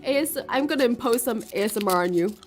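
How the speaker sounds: laughing loudly. Real, not acted. Joking about imposing ASMR.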